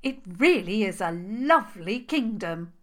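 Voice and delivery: gushing, over-impressed praise. A narrator's reading. Princess flattering the troll king's kingdom, impressed. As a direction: lay on the admiration thickly. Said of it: "sounding impressed".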